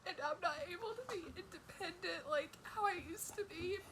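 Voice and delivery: blubbering. Real, not acted. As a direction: let the sobs break the words. Crying about partner's burden.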